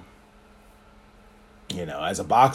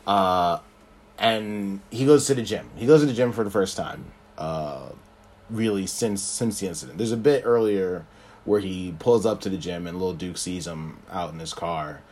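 normal talking voice

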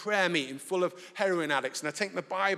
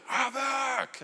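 higher call of the dog's name; 8 semitones higher than usual. Retold or imitated. The mate calls his dog off, sharp call. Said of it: "My mate thankfully calls him".

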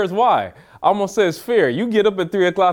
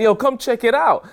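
high, eager beckoning; retold giddy invitation; excited; 7 semitones higher than usual. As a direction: imitate her urging you to come look. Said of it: "She excited".

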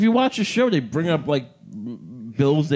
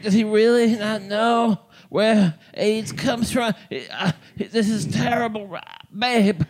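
vocal fry, slow and high, mocking the partner; impression of partner ranting at TV; drunk angry impression; 5 semitones higher, slower than usual. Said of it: "do your impression of me watching The West Wing".

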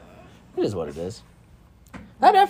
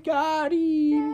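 happy Got him; 11 semitones higher than usual. Retold or imitated. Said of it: "He's so happy, though, when he says it".

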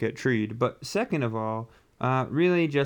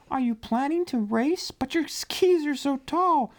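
very high, squeaky little voice; snow-folk character voice; 12 semitones higher than usual. Acted. Snow folk question the newcomer penguin, high character voice. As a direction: question him in a tiny high-pitched voice.

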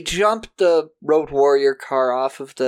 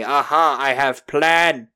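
slow, broken-English villain; villain accent; 4 dB louder, slower than usual. Retold or imitated. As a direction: gloat in a thick accent. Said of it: "evil cop contacts them to say".

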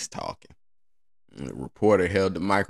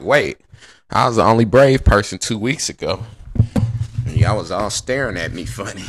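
mock indignant; 3 semitones higher, 7 dB louder than usual. Comedian voicing himself indignant.

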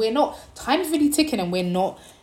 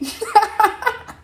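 high burst of laughter; real high-pitched giggling laugh; amused laugh; 13 semitones higher than usual. Real, not acted. Laughs at her own boat story.

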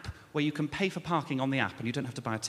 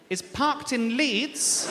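is parked in Leeds slow, high, drawn out; stress 1; 7 semitones higher, much slower than usual. Retold or imitated. Comedian to the officer, slow incredulous emphasis. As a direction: spell out "parked in Leeds" slowly, pitched up. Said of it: "That's what you're suggesting I've done".

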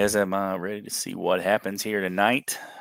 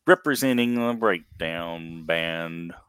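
ordinary talk, nothing marked

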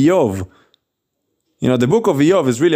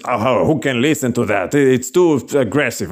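lower-pitched mock objection; offended listener; 4 semitones lower than usual. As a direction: imitate someone put off by the preacher. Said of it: "call them offensive".

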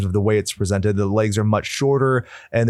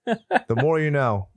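slow PSA announcer voice; drawn-out public service slogan; 3 semitones higher, slower than usual. Pretend.

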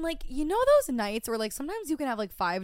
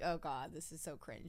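cringing aside; 7 semitones lower, 14 dB quieter than usual. Retold or imitated. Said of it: "And I'm like".

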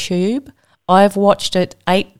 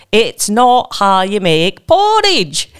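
slow Scottish ad impression; Scottish accent; much slower than usual. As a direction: draw out a hammed-up Scottish accent. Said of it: "randomly go into a Scottish accent".

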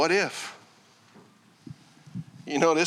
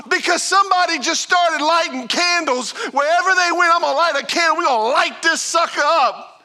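raised, driving sermon voice; fervent preaching; 8 semitones higher, 6 dB louder than usual. Acted. Pastor to his church, fervent preaching. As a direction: paint the dream with rising fire. Preacher casts the vision loudly.

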